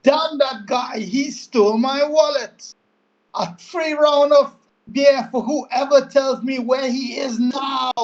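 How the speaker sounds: high, in-character angry shout; high-pitched outburst, dragging out the end; angry tavern shout. Acted. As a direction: call out angrily to the whole room. Player acts angry tavern announcement.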